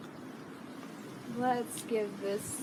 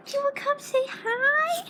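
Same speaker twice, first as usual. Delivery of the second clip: high, loud pet-talk voice; talking to a dog; 5 dB louder than usual. Real, not acted.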